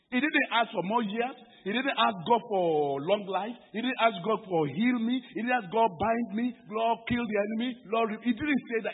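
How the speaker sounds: high, loud, insistent preacher's list; emphatic preaching. Real, not acted. Preaching a raised list of unasked requests.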